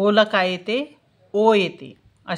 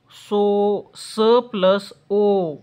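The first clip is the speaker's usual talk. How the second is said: slow, each part laid out; slow breakdown; much slower than usual. Shown as a demonstration. Teacher showing students how सो splits, slowly. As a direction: spell out स plus ओ slowly.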